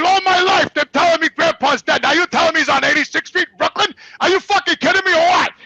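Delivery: loud, high-pitched indignant rant; retold incredulous tirade; outraged disbelief. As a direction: act out confronting your cousin, voice way up. Retold angry confrontation with cousin.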